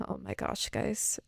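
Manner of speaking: breathless whisper; whispered dread. Real, not acted. Vlogger whispering to viewers at the thought of Tom dying. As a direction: drop to a whisper as if it's too awful to say. Dreading Tom's death in the movie.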